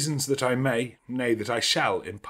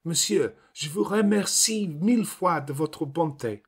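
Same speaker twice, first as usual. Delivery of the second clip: put-on higher, theatrical little French girl; exclaiming French girl; 8 semitones higher than usual. A narrator's reading. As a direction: gush your thanks like a tiny actress.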